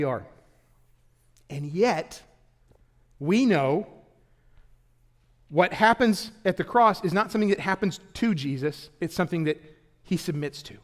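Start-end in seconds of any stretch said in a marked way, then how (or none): none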